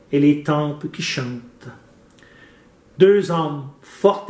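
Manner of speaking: measured, carefully articulated verse reading; declaimed poem with deliberate phrasing; poem recitation. Acted. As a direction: recite line by line, with pauses.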